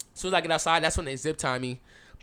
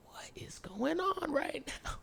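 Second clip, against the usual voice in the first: high, slow, soft and mostly whispered; bewildered whisper; 12 semitones higher, 10 dB quieter, much slower than usual. Retold or imitated. Storyteller watches the van being loaded, bewildered whisper. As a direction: stretch the question out in hushed disbelief.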